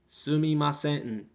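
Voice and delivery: Sumimasen said slowly, every syllable clear; slow, careful Sumimasen for the class; slow careful take. Shown as a demonstration. Teacher first giving students the careful Sumimasen, slow. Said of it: "And normally it just sounds like".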